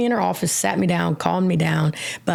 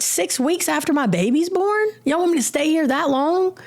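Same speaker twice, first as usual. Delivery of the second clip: indignant outburst; 7 semitones higher than usual. Retold or imitated. Retold outburst at counselor.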